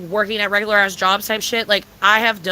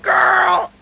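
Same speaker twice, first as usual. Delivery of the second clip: laughing exclaim; 8 semitones higher, 4 dB louder than usual. Retold or imitated. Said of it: "I was like".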